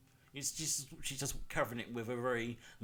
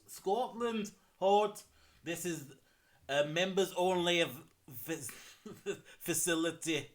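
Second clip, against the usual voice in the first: a hammed-up Scottish accent, higher; 4 semitones higher, 4 dB louder than usual. Gamer voicing a golf club guard in Scottish accent. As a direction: play a Scottish club doorman. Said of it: "think of an accent for this one".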